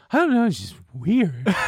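high, airy put-on voice of a bored date; imitating his date's voice. Guy imitating his dinner date at a Mexican restaurant, high mocking voice. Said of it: "Not only is this exactly how she sounds".

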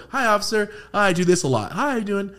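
high, overly polite greeting; high innocent hi to a cop; sweet polite voice to cop. Retold or imitated. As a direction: sweeten it up for the officer. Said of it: "That's why I just go".

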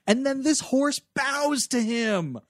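ordinary talk, nothing marked

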